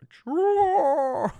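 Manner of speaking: high, slow, stretched sneeze; fake sneeze. Shown as a demonstration. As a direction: drag out a big fake sneeze. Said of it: "I feel like it's deeper though".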